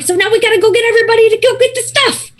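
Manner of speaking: fast, high, frantic gabble; frantic urgency. Acted. Farmhand urging cousin to round everyone up, frantic. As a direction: rush it out in one panicked breath.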